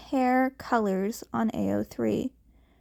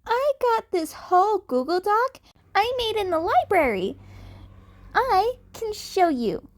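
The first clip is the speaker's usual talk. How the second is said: much higher, keen; eager kid Deku; 9 semitones higher than usual. A narrator's reading. Cat kid offering his Google Doc, high and eager.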